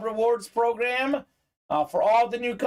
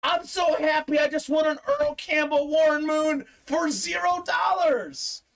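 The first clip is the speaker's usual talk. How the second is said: higher, shouting through happy tears; mock happy shout; 7 semitones higher than usual. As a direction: ham up a joyful outburst. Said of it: "She's like".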